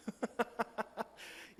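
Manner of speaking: soft, voiceless run of chuckles; breathy laugh. Real, not acted. Preacher amused by coworker's likes and hates, breathy laugh. Laughs after explaining Japanese expression.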